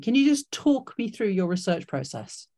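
normal talking voice

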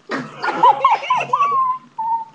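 high shrill burst of laughter; loud shrieking laugh. Real, not acted. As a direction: crack up loudly. Laughs at "cooked" shoes.

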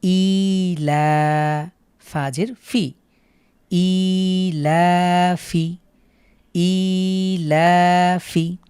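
"ই লা ফি" read very slowly, vowels held long; drawn-out recitation. Shown as a demonstration. Teacher models the full word, slowly. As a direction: stretch the লা and the ফি one alif each. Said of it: "লাম খাড়া জবর লা".

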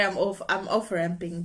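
usual speaking voice

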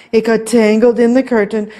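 ordinary delivery

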